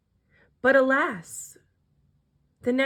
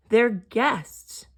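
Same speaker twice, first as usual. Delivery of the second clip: usual speaking voice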